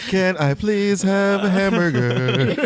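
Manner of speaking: high, slow pleading customer voice; playful mock customer plea; customer asking. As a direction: ask sweetly like a sample-hunting shopper. Pretend customer asking for samples.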